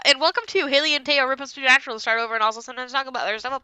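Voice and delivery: very fast, breathless-sounding title run; rapid-fire. Real, not acted. Host redoing the intro with the long title, rapid-fire. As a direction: rattle off the long show title.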